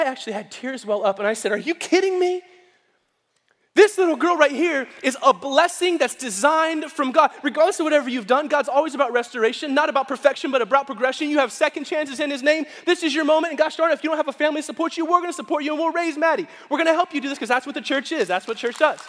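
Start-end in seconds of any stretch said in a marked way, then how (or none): 1.5-2.4 high-pitched disbelieving retort; retold outraged disbelief; incredulous. Tearful incredulous reply retold. 3.8-19.0 high, driving preacher's assurance; emphatic rallying sermon voice; impassioned preaching. Retold or imitated. Preaches reassurance to the young mother.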